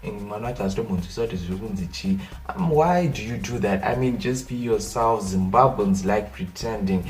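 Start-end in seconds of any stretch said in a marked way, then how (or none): none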